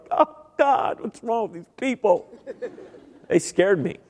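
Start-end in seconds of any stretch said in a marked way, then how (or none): 0.1-2.3 high, alarmed aside; much higher mock alarm; freaked-out newcomer. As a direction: squeal it like someone spooked by worship. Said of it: "I was like".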